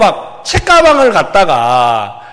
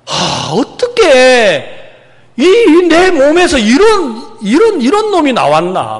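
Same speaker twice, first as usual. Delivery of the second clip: exasperated outburst; 5 semitones higher, slower than usual. Retold or imitated. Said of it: "너무너무 화가 나가지고".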